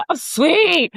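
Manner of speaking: high-pitched tearful reply, played up; mock drunk crying. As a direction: act out a drunk, weepy thanks. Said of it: "drunk, crying".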